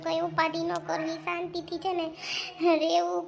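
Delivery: ordinary talk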